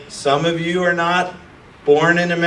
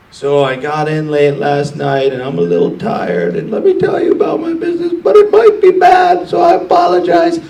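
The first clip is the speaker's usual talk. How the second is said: whiny mock pitcher; 4 dB louder than usual. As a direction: squeak out the excuses like a whiny kid. Mocking an excusing founder.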